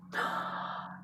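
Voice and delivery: sharp intake of breath; acted gossipy gasp; scandalized gasp. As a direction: gasp at a juicy new theory. Wife struck by an idea.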